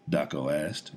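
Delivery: ordinary delivery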